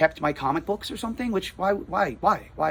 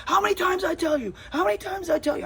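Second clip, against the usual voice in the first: very high-pitched mock scream; retold screaming; 14 semitones higher than usual. Neighbor cutting off the store owner's explanation, mock screaming. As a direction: imitate a shrill-pitched, repetitive scolding without full volume. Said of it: "he's screaming at me".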